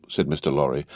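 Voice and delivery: ordinary delivery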